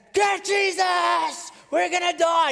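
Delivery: high, loud yell over the storm; panicked disciples. Acted. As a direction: shout in alarm to wake him.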